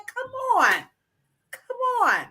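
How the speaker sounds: high, exasperated plea; mock pleading. Comic pleading for Jamaican oil.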